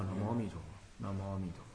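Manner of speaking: low, very soft Buddha-name chant; hushed, low devotional murmur; slow settled recitation. Shown as a demonstration. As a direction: recite inwardly, barely voiced, letting the mind settle. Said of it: "轻轻的提着".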